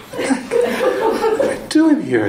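amused disbelief. Retold or imitated.

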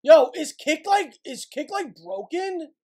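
real frustrated shout at the stream platform; loud, exasperated yelling at a broken tool; furious streamer yelling; angry yelling. Real, not acted. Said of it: "I probably wouldn't be screaming like that".

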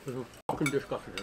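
spluttering with food in mouth; talking through a mouthful; mouth full. Real, not acted. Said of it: "you keep spitting at me".

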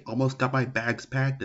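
ordinary talk, nothing marked

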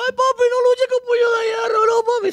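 put-on high pleading child's voice; crying child. Teller voicing the crying son begging his father, high and pleading. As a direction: beg your dad like a scared little boy. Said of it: "Se puso a llorar".

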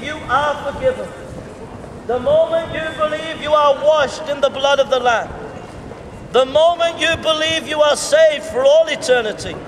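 ordinary talk

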